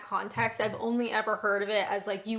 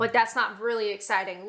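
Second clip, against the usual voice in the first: ordinary delivery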